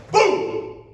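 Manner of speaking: very high, loud, explosive "boom"; impact sound. Shown as a demonstration. Teacher demonstrates circular momentum, explosive boom. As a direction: burst out a boom to show power.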